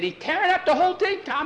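mock frantic kid; frantic report. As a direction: blurt it out breathless with alarm. Kid reports horse rampage.

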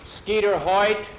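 sharp rise in volume and pitch; stern call-out. Acted. Teacher catches a boy starting early, stern call-out.

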